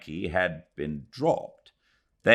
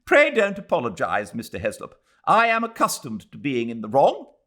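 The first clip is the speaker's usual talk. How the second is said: loud, high wounded sarcasm; icy sarcasm; 9 semitones higher, 6 dB louder than usual. Acted. Mrs Turton snubbing Mr Heslop, loud sarcasm. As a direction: bite each word off coldly.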